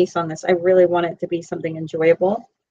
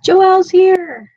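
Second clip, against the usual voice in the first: high, loud, slow and drawn-out welcome; excited greeting; 9 semitones higher, 7 dB louder, much slower than usual. Real, not acted. Greeting Joelle as she joins the live stream, excited. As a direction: stretch her name out with delight.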